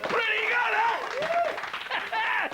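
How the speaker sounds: thrilled the guess came off; delighted. Real, not acted. Trick works by fluke.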